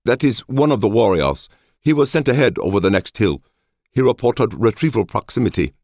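high, formal reporting voice; high-pitched, respectful underling; deferential scout officer. A narrator's reading. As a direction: report to your lord in a high voice.

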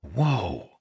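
quiet impressed whoa under breath; muttered. A narrator's reading. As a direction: mutter it to your friend. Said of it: "Ilden muttered".

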